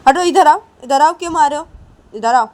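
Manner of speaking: loud, high urgent command; calling out. Acted. Ravi calls his son away, loud. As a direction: pull the child away sharply.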